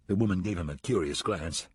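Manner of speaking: ordinary delivery, nothing marked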